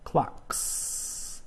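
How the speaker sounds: "Clocks" softened, final S drawn out as a hiss; unvoiced S ending. Shown as a demonstration. Teacher confirming the S column, "Clocks" with hissed ending. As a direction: let "Clocks" trail into a long voiceless S. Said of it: "it goes in the S column".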